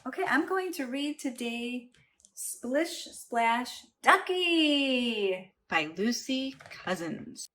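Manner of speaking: ordinary delivery, nothing marked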